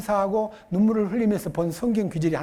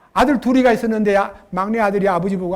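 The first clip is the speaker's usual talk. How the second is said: usual speaking voice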